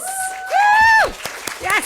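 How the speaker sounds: high, loud cheering woo; excited whoop. Real, not acted. Host cheering the author on stage, high and loud. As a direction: whoop to welcome her in.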